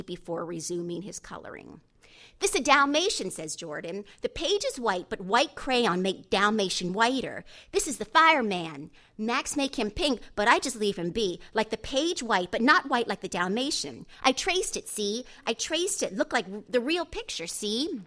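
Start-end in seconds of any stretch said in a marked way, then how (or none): 2.4-3.3 put-on higher, louder little-boy voice; excited young Jordan. A narrator's reading. Seven-year-old shows the lawyer his coloring, excited child voice. 4.2-18.0 put-on higher, louder little-boy voice; excited young Jordan. A narrator's reading. Seven-year-old shows the lawyer his coloring, excited child voice.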